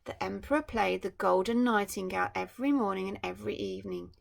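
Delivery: bright storybook reading voice; story narration. A narrator's reading. Parent reading a picture book to a child, storytelling. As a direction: read it like a bedtime picture book.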